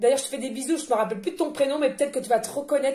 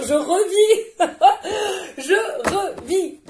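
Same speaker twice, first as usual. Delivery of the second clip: joyful laughter; 4 semitones higher, 5 dB louder than usual. Real, not acted. Joy after the burning stopped.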